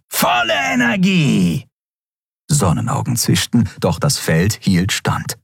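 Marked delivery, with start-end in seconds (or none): 0.1-1.7 high, slow battle shout; roared command. A narrator's reading. Shadow Skria demanding full power, roaring. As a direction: shout the order, drawn out and high. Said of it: "brüllte der Schattenskrier".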